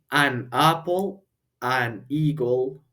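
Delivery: "an apple", "an eagle" said slowly, linked; slow teacher model of "an apple"; slow model words. Shown as a demonstration. Teacher modelling words slowly for the class. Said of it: "Es decir".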